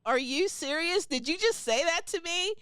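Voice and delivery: high, stunned disbelief; mock-offended mother; incredulous. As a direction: ask it as if you can't believe your ears. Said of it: "And I'm like".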